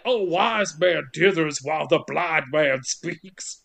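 slow, hammed-up riddle-speak; mock sage. Prisoner riddling at the sheriff, slow mock-mystic tone. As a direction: intone it like a cryptic oracle. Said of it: "You'd be like".

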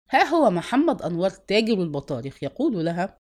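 ordinary delivery, nothing marked

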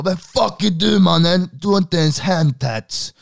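tattooed guy shouting at a friend in line, mock furious; loud aggressive yell. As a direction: yell it at the whole restaurant like a thug. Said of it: "Högt över hela donken".

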